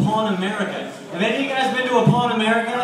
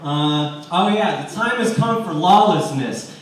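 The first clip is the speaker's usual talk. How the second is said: ordinary talk, nothing marked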